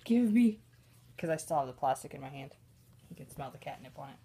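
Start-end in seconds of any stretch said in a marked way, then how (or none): 0.0-0.6 high, loud pleading cat voice, imitating Patches; greedy cat voice. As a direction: beg for the catnip as the cat. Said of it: "He's like".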